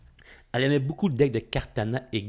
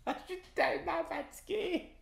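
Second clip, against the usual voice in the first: laughing tired; 7 dB quieter than usual. Real, not acted. Laughing at own mistake.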